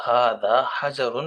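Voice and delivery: حجر slowly with a clear a on the jeem; slow correction; jeem with zabar. Shown as a demonstration. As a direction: model ھذا حجر slowly. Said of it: "جیم پہ زبر پڑنی ہے".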